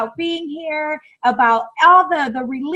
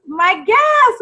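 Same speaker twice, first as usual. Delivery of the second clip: higher and louder shout for the guest; excited announcement; 7 semitones higher, 3 dB louder than usual. Real, not acted. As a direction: call the guest in with a cheer. Calling the guest in.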